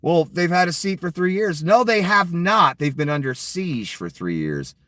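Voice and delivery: rising into an outraged no; angry retort. Real, not acted. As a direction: fire it back hot and indignant. Angry rebuttal of Trump quote.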